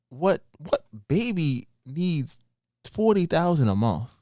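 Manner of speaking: slow incredulous. Real, not acted. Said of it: "incredulous question".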